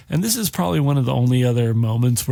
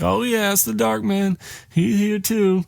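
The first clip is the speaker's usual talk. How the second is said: lightly voiced old woman; 9 semitones higher than usual. Mother Abigail warns of Dark Man.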